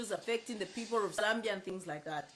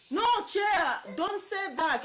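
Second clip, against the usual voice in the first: higher-pitched retold plea; protesting; 7 semitones higher, slower than usual. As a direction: act out an urgent objection, pitched up. Acts out messenger protesting her angry reply.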